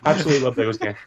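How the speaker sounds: put-on high, eager kid voice; gushing little boy. Little fan gushing to Dan, high and eager. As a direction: gush like a starstruck child.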